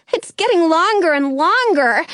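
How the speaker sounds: usual speaking voice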